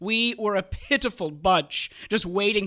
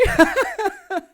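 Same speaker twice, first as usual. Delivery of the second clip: high gloating chuckle; evil chuckle; 13 semitones higher, 6 dB louder than usual. Acted. Kay laughing at his own jab, high gloating chuckle. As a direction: chuckle at your own joke.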